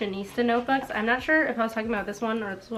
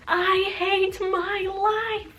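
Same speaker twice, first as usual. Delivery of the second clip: dramatic whine; 10 semitones higher, much slower than usual. Retold or imitated. Dramatic diary line read aloud.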